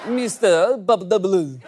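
put-on Russian accent. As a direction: purr the name in a Russian accent. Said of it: "saying your name in a Russian accent".

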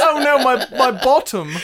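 high, loud comic dismay; half-laughing cry of embarrassment; mock dismayed Englishman. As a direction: lament it like a flustered stage Brit. Voices embarrassed English actor.

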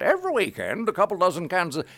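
put-on high, loud old New England announcer; old New England man. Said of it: "like an old man from New England".

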